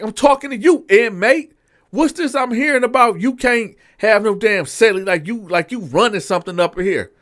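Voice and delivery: high, loud mock scolding; captain chewing out the inmate; confrontational captain. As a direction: put the inmate in his place.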